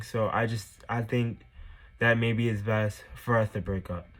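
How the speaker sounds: soft, low, careful delivery; super nice. Retold or imitated. Girlfriend nervously messaging her boyfriend about breaking up, super nice. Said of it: "This is me. Super nice".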